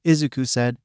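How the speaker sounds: plain reading voice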